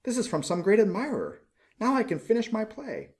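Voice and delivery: high, joyful exclamation; a poor writer suddenly thrilled; rising delight, pitch up; overjoyed young man. Acted. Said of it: "he cried".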